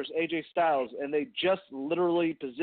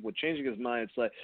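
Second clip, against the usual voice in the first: normal talking voice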